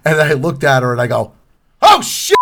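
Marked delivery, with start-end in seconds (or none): 1.8-2.4 much higher, loud shocked shout; shocked exclamation. Retold or imitated. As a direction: blurt it out the moment you see her. Puts glasses on, sees star.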